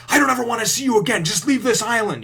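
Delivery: a hammed-up angry screech; furious shout. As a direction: yell him away in a rage. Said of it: "and she's like".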